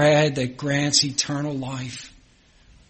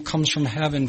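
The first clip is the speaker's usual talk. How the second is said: ordinary talk, nothing marked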